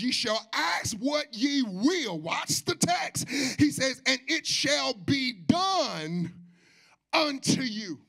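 high, loud, slow preaching cadence; full-force preaching. Real, not acted. Preacher proclaims answered prayer to the congregation, slow and loud. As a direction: proclaim it, stretching every phrase.

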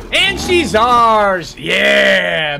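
loud, drawn-out victory cry; triumphant cheer. Real, not acted. Player celebrating finally taming the bear, triumphant shout. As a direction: shout it out, stretching the words in triumph.

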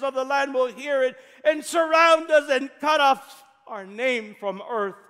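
high, loud, shouted plea to God; anguished loud wailing prayer; Joshua's loud lament. Acted. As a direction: cry out to God in despair. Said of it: "And Joshua said".

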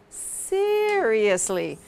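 stretched out very slowly; slow modelling. Shown as a demonstration. Teacher modelling the word slowly for a student, stretched out. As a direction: draw "seriously" out, syllable by syllable. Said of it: "teacher models word slowly for student".

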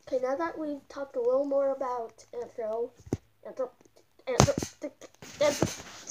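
muffled through mascot head; talking inside costume head. Real, not acted. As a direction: voice inside full-head mask. Seen 4: Full costume animal head worn over face throughout all frames.

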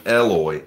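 "Eloy" starting like the letter L; short-E first syllable. Shown as a demonstration. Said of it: "Second one".

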